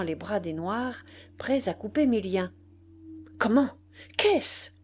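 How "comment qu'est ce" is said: high, loud, incredulous questions; shocked outcry; startled exclamation. A narrator's reading. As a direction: cry out in disbelief, pitch jumping up. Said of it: "s'écria-t-il".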